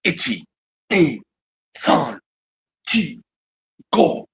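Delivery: separate, punched counts with pauses; martial arts count. Shown as a demonstration. Demonstrating his martial arts training, measured drill count. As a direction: count out drill reps, one at a time. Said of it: "martial arts my whole life".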